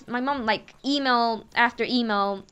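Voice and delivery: normal talking voice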